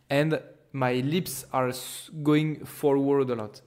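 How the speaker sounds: ordinary delivery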